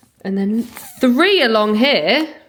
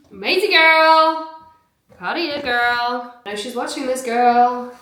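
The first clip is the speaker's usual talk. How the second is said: hammed-up American cheer, higher; mock American accent; 4 semitones higher, 3 dB louder than usual. Praising a follower in mock American accent. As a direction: gush in a put-on American accent. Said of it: "I don't know why I'm American".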